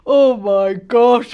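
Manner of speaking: loud, drawn-out gasp of wonder; mock amazement. Mum and dad react to the girls, hammed-up amazement. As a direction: stretch it out big, nearly laughing.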